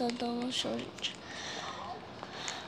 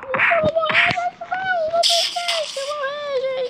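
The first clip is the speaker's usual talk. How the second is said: shrill panicked cry, much higher; panicked high squeal; 4 dB louder than usual. Real, not acted. Gamer to viewers, about to die in the game, panicked high squeal.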